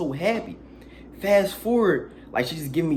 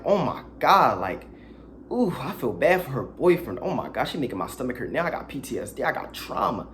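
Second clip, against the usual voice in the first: ordinary talk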